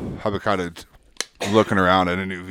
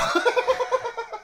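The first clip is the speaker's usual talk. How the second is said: high shrieking laugh; 3 dB quieter than usual. Real, not acted. Laughing at numb-tongue joke.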